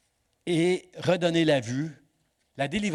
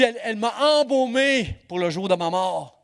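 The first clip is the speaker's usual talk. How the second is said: Jesus quoted; 6 semitones higher, 4 dB louder than usual. Retold or imitated. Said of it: "Jésus dit".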